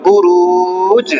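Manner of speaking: "বুরুজ" with a very long "রু" and bounced final "জ"; Quranic pronunciation demo. Shown as a demonstration. Teacher modelling a double sukun word, drawn-out recitation. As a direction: slowly stretch "বুরুজ", then release the last consonant. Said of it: "কি হলো উচ্চারণ".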